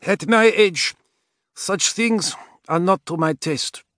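put-on high, slow haughty old woman; a proud old lady, unhurried. A narrator's reading. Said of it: "the old woman".

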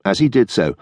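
ordinary delivery, nothing marked

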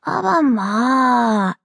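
very slow, drawn-out "Aber Ma"; exaggerated patience. Daughter explaining to her furious mother, patronisingly patient. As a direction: stretch it out as if to a small child. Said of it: "erklärte Delly so geduldig".

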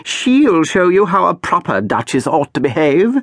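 high, loud, lofty instruction; haughty archduchess. A narrator's reading. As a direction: lecture from the doorway. Archduchess lectures the child.

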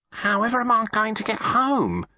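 high-pitched plaintive moan. A narrator's reading. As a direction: wail it like a helpless complaint. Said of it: "she moaned".

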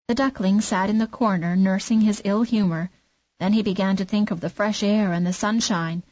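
ordinary delivery

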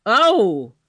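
high, loud startled "oh"; startled cry. Acted. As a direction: exclaim as if badly shaken.

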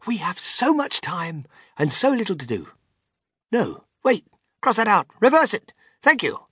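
high, hurried-sounding self-correction; excitable inventor tripping over his own speech; flustered showman inventor. A narrator's reading. Mister Wonka correcting himself to the passengers, excitable and high.

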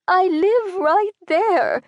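put-on high, slow, desperate elderly voice; an old lady begging not to be robbed; pleading old woman. A narrator's reading.

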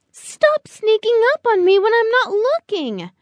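very high, indignant cat voice; screechy cat scolding. A narrator's reading. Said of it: "he heard a loud screech".

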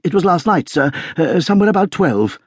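high-pitched, agitated report; excited servant reporting to his master; shaken butler. A narrator's reading. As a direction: blurt out the awful news. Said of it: "propriety shaken out of him".

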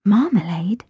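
"Marmalade?" with a questioning rise, a bit put out; "Marmalade?" lifted as a wary question; doubtful question. A narrator's reading. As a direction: echo "Marmalade?" with unsure disapproval. Amy stiffened.

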